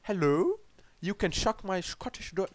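British accent slipping into Scottish; a rough go at a British accent; attempted British accent. Shown as a demonstration. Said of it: "you have the British accent, like this".

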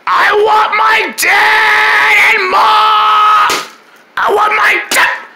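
high, loud, slow bawling cry; wailing for parents. Acted. Scared kid bawls for his mom and dad, loud wailing sob. Frightened character wails for parents.

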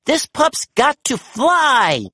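high, slow, gleeful cry; thrilled to take off; excited exclamation. A narrator's reading. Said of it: "Sky exclaimed".

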